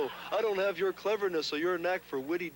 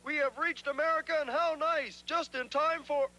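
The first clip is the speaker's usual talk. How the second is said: hammed-up pilgrim actor; 6 semitones higher than usual. Child acting in school play.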